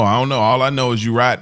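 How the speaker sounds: normal talking voice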